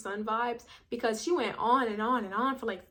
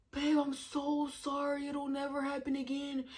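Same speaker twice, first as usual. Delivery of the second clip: mock apologetic; 4 semitones higher, 4 dB quieter than usual. Boyfriend apologizing for lost ring.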